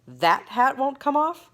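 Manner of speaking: mock the boy's hat meanly; mean mockery. Duke mocking Bartholomew's hat, slow mean sneer. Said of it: "laughing meanly".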